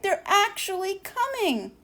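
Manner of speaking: thrilled at what she sees; excited. Acted. Moon watching the spaceships approach, high and excited.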